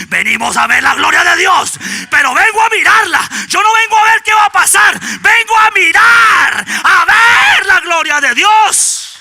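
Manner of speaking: predica a todo pulmón, más agudo y fuerte; proclámalo con fuego, alargando mirar y gloria; predicación a gritos. Acted. Preaching at full force. (predicador gritando con fervor)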